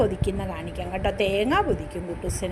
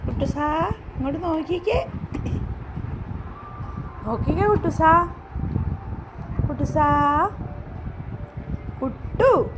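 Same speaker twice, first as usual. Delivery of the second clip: slightly higher coaxing calls; coaxing the dog; 6 semitones higher than usual. Real, not acted. Owner coaxing her dog to look, higher calls. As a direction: coax him to look at the camera.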